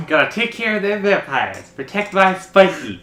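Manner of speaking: put-on high character voice; Buffy bot impression. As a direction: play a devoted robot girlfriend on patrol. Voicing the Buffy bot on patrol.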